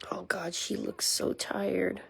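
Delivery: slow, hushed whisper. Real, not acted. About the exhausted mother dog, a slow whisper. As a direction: whisper slowly so as not to wake her.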